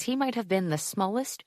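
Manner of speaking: plain reading voice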